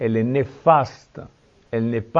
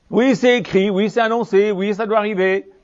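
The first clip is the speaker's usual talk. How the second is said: mocking sarcasm; 6 semitones higher than usual. Mocking repetition of objectors.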